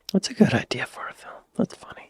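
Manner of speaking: breathy whispered aside. Real, not acted. Host whispering an aside to himself while reading, whispered. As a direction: whisper it to yourself.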